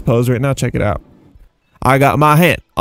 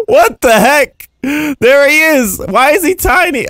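baffled surprise; 10 semitones higher, 3 dB louder than usual. Real, not acted. Surprised by tiny model.

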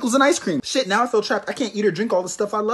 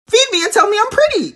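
high, loud, pampered princess voice; bratty high whine asking for compliments; demanding diva; 14 semitones higher, 6 dB louder than usual. Acted. Pregnant diva demanding attention.

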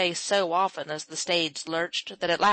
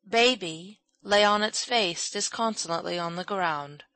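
usual speaking voice

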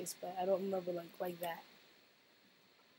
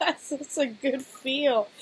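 laughing; 4 semitones higher, 4 dB louder, much slower than usual. Real, not acted. Laughing while reacting to video.

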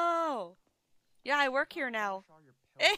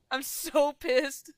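mock mad; 5 dB louder, much slower than usual. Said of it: "'Cause I'm mad".

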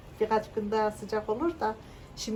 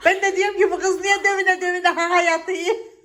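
laughing through the words; giggling while telling it; 9 semitones higher than usual. Real, not acted. Telling a funny story, laughing.

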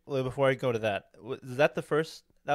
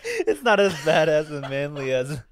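laughing, higher and louder defense; embarrassed laughter; 4 semitones higher, 9 dB louder than usual. Real, not acted. Defending the anime pick to friends, laughing with embarrassment. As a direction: get it out through sheepish laughs.

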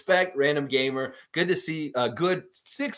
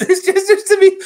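high, loud laughter breaking through the words; can't get the line out for laughing; laughing through a quote; 14 dB louder than usual. Real, not acted. Reading a fan's quote while cracking up laughing.

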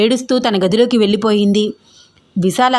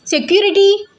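high-pitched acted shout; loud call; 10 semitones higher than usual. A narrator's reading. Angry young woman summons the guards, high acted yell. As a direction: call out sharply for help, voice pitched right up. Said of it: "అని గట్టిగా అరిచింది చిన్ని".

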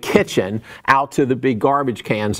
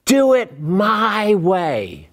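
insistent God; 5 semitones higher, much slower than usual. Acted. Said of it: "he insisted".

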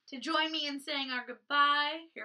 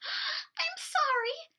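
high, slow apologetic bird; tall bird voice; much slower than usual. Acted. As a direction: stretch it out high and timid. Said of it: "said a very tall bird".

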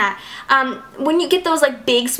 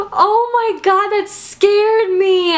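higher, louder, slow shaken words; real fright; 8 semitones higher, 4 dB louder, much slower than usual. Real, not acted. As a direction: still rattled from the scare. Just got scared by a spider.

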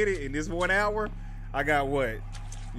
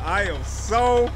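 louder, higher, drawn-out "so"; exasperated; 5 semitones higher, 6 dB louder, slower than usual. Real, not acted. Player shut out by the gate, rising exasperation. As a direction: stretch "so" upward in exasperation. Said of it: "I'm so annoyed".

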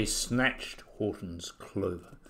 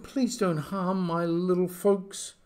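begging; 6 semitones higher than usual. A narrator's reading. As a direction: beg earnestly, lingering on the words. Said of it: "And begged".

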